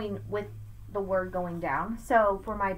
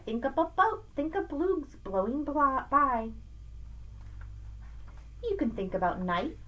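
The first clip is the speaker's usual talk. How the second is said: lively sing-song picture-book read; reading to children; 2 semitones higher than usual. Acted. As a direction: read Seuss aloud to a child, bouncing the rhymes. Reads Dr. Seuss aloud.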